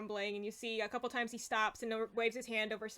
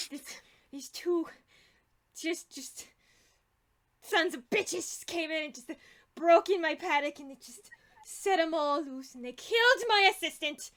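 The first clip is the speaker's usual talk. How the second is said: high, halting, increasingly loud outrage; worked-up, high-pitched complaint; indignant goblin rant; 5 semitones higher, 6 dB louder than usual. Acted. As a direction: sputter the grievance, building to a loud cry. Goblin rants about the intruders.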